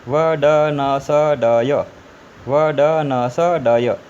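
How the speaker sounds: वडनसडय read slowly, vowels dropped, each consonant drawn out; slow consonant-only reading. Shown as a demonstration. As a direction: spell out वडनसडय syllable by syllable, very slow. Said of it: "फिर पढ़ना है". (स्वर हटाकर धीरे-धीरे पढ़ना)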